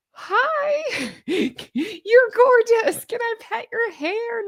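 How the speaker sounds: high-pitched, drawn-out gush with giggles; starstruck fangirl gushing. Pretend. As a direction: gush like a giddy fan meeting an idol. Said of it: "I would just be like".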